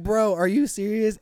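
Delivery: high, loud, incredulous question; retold disbelief. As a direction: hit it like you can't believe it. Disbelief at the guy's story.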